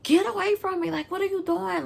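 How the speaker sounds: high, agitated mock scream; strained high yell, kept contained; retold screaming. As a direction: cry out to push someone off.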